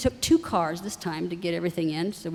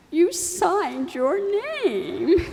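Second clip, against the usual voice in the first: higher, slow mock-tearful quote; teasing echo; 7 semitones higher, much slower than usual. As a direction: throw her own words back at her playfully. Daughter mimicking mom's old line.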